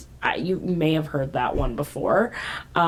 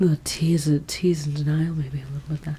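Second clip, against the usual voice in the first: sultry whisper; 3 semitones lower, faster than usual. Pretend. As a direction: purr it low and secret. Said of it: "do you like my sultry voice".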